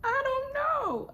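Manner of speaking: very high, loud, drawn-out whine; mock crying wail. Crying new mother answering her man, exaggerated high wail. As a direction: imitate yourself wailing helplessly. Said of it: "I can't tell you how many times I'm crying".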